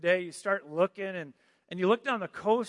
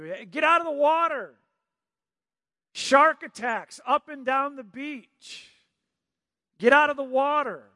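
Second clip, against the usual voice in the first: higher, louder lifeguard warning shout; lifeguard yelling; 7 semitones higher, 4 dB louder than usual. Retold or imitated. Lifeguard yelling at swimmers from the shore. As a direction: yell them out of the water. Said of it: "he just starts yelling at you".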